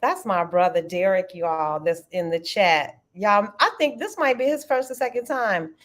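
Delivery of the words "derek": normal talking voice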